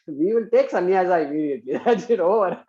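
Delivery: chuckling through the words; laughing. Real, not acted. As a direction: laugh while joking. Mocking quick fix, laughing.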